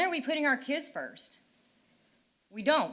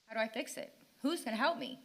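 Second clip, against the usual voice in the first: much softer, a defeated plea; lost and defeated; 5 semitones lower, 7 dB quieter than usual. Real, not acted. Mother asking the council for help, quiet and defeated. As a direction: let the voice give out. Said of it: "I'm lost for words here".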